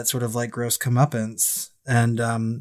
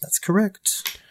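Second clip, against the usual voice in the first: higher-pitched mock British echo; mock British accent; 7 semitones higher, much slower than usual. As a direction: playfully mimic the British bold R. Echoing Grady's British bold R's.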